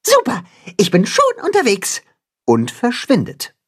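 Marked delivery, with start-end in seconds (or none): 0.0-2.0 high, eager cheer; high delighted mole voice; excited mole. A narrator's reading. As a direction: burst off keenly. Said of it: "Begeistert schaut Max".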